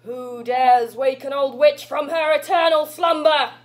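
awaken as an ancient, affronted witch; old witch. Acted. Actress voicing Granny woken from slumber, imperious old witch. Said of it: "Do you want me to sound old".

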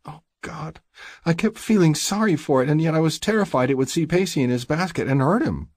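high-pitched, distressed female character; shaken woman. A narrator's reading. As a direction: confess the fright in a high voice. Shaken Stacy confesses her terror.

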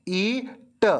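Eat with a long stretched ee; long ee sound. Shown as a demonstration. Teacher showing pupils the long ee vowel. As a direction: draw out the ee in Eat. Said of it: "इसको कैसा बोला मैंने".

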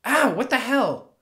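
sudden loud yelp of pain; jolted by an unseen hit; startled pained cry. Acted. Hit by invisible shadow.

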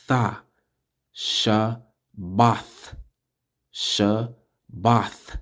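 "Sha", "Ba", "Tha" each repeated separately, then "Shabbath"; syllable drill. Shown as a demonstration. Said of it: "when you see the pronunciation".

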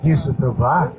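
intense pleading prayer, rising on each plea; fervent prayer. Acted. Preacher prays over the congregation, fervent pleading prayer. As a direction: pour it out to Jesus, pleading.

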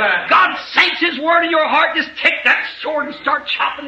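high shouted proclamation; preaching shout. Real, not acted. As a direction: proclaim it to the whole hall. Preaching climax.

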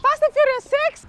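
slow, high falsetto voice; really high-pitched. Pretend. Fake employee obeying the prank order, really high-pitched. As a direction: push it way up high and draw it out. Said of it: "talk really high pitched".

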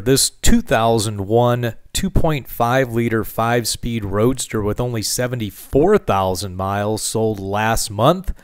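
ordinary talk, nothing marked

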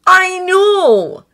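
high, loud, desperate; desperate concession. A narrator's reading. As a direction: give in with desperation. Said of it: "Draco conceded a little desperately".

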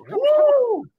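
high-pitched, loud "woo"; loud whoop. Real, not acted. Streamer breaking off his banter, loud whoop. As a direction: let out a sudden high whoop.

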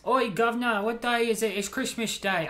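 loud, high, hammed-up Cockney-style British accent; badly faked British street-urchin cry. Comedian showing he can't do accents, hammed-up British accent. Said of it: "I can't do accents, dude".

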